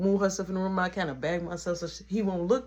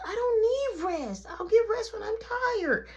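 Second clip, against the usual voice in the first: put-on high-pitched childish refusal; imitating a stubborn small kid; put-on child voice, protesting; whiny little boy. Little boy argues back.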